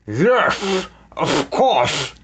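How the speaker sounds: wheezy villain voice; wheezing. A narrator's reading. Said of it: "Snatcher wheezed".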